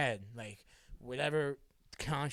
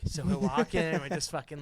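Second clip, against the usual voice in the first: ordinary talk, nothing marked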